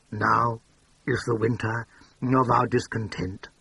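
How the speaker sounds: usual speaking voice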